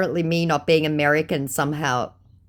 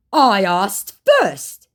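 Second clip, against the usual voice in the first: slow, drawn-out, louder insistence; insistent scammer; 4 semitones higher, 6 dB louder, much slower than usual. Retold or imitated. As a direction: stretch it out like a stubborn scammer. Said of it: "he said".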